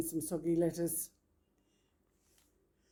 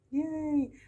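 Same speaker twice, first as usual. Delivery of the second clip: excited cheer; 9 semitones higher than usual. Real, not acted. Cheering about dinner.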